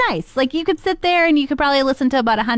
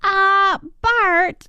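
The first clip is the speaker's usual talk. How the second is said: Marge Simpson attempt; 8 semitones higher than usual. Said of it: "Marge Simpson".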